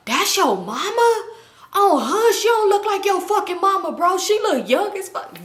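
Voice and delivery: high-pitched, incredulous exclaiming; disbelieving friends. Retold or imitated. Son's friends doubt she is his mama, imitated high disbelief. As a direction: imitate astonished onlookers doubting the claim.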